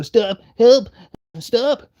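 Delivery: high, loud pretend pleading; mock cry for help. Mocked man getting jumped, mock cry for help. Voices victim being beaten up.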